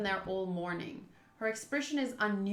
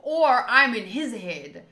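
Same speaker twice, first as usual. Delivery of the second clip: loud, high excited voice; thrilled loud outburst; 5 semitones higher, 12 dB louder than usual. Real, not acted. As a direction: exclaim it loudly in delight. Excited realization about playing role.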